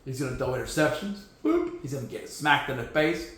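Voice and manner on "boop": high playful boop; boop sound. Pretend. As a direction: chirp a silly boop.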